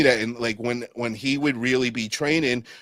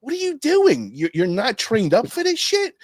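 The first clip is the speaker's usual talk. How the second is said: pitched way up in disbelief, a little louder; incredulous high mock; 11 semitones higher, 3 dB louder than usual. Teasing his buddy, high-pitched disbelief. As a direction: squeak it out like you can't believe him. Mocking an untrained friend.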